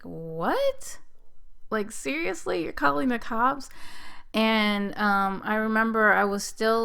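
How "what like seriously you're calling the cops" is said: pitched way up in disbelief; outraged can't-believe-it, much higher; hearing the husband call the cops, shocked disbelief; incredulous disbelief. Retold or imitated. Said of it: "I was just like".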